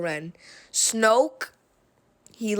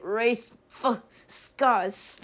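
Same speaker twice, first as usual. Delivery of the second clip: reluctant stumble; slower than usual. Real, not acted. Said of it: "I can't say it".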